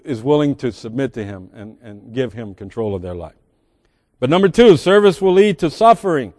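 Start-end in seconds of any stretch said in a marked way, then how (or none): 4.2-6.3 repeats the sermon point with weight; preaching. Acted. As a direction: drive the point home again. Restates the point.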